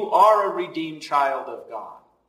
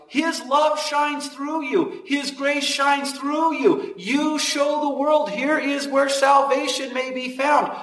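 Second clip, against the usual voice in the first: slow, higher, ringing preacher lift; fervent preaching; 6 semitones higher, slower than usual. Acted. Preacher to his congregation, slow and lifted. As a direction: raise it up and stretch each phrase.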